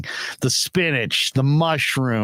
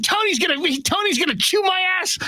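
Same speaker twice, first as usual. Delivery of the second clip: mock panicked yell, much higher; imitated yelling. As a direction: shriek it like a boss about to get chewed out. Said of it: "He's screaming, he's yelling".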